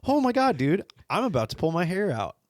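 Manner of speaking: high, mock-exasperated outburst; hammed-up childhood exasperation. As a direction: replay being driven up the wall, pitch leaping then falling. Retells exasperation at sister's chewing.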